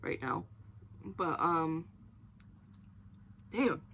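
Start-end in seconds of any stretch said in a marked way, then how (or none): 0.0-0.4 talking around sticky chocolate; words through a sticky mouthful; chewing sticky toffee. Real, not acted. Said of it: "it is stuck to my teeth right now".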